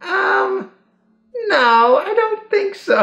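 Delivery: very high, lazy answer; high and lazy, brushing it off; bored witch voice. Acted.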